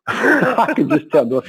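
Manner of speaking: ordinary delivery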